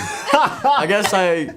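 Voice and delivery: high, slow words lost in laughter; laughing helplessly. Real, not acted. Explaining nickname while cracking up.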